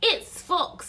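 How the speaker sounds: high, loud little mouse voice; mouse character. Acted. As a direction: pipe up as a confident mouse. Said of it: "said the Mouse".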